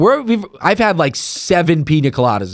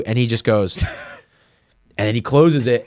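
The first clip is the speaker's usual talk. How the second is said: ordinary delivery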